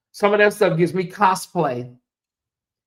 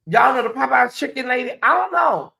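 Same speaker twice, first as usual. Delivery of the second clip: normal talking voice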